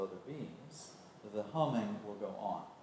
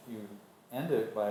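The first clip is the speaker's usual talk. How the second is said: usual speaking voice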